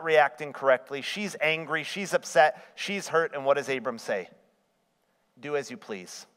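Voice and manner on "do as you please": resigned mutter. Retold or imitated. Abram gives in.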